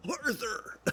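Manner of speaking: a hammed-up try at a gang boss; Dutch voice. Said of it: "get my Dutch voice".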